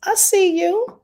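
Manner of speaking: high, drawn-out, impressed "Oh, okay"; impressed viewer. Retold or imitated. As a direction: play a viewer who is suddenly impressed. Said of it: "you want them to be like".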